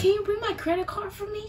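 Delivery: high mock sweet request; Nana asking. Imitating Nana asking for her laptop from bed, high sweet mock. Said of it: "Nana could be like".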